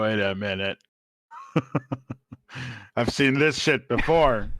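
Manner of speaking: normal talking voice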